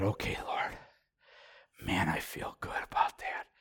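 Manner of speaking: whispered relieved prayer to the Lord; hushed prayer. Retold or imitated. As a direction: breathe it to God in a whisper. Quietly relieved prayer retold.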